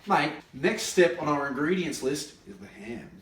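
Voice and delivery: ordinary talk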